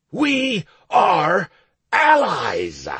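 loud barking declaration; barked shout. Acted. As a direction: bark it out like a demon. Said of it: "I barked quite convincingly".

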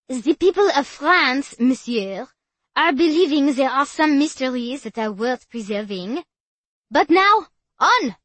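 put-on loud tour guide, French accent; French guide addressing tourists. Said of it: "heavy French accent".

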